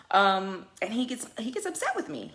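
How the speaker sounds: ordinary talk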